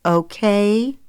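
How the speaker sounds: pointed rising okay with a warning. Acted. Zoe presses her father with a warning okay. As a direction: press him for a promise. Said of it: "she gave him a warning look".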